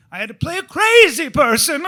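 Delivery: sudden loud, high burst; mock crazy shout. Recalling a bad audition, he yells like a crazy person.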